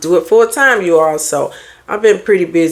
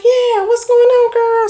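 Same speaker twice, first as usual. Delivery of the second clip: put-on much higher, sing-song hello to a girlfriend; cheerful high greeting to a friend; friendly greeting. Shown as a demonstration. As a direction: greet your girl warmly and high. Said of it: "Something like that".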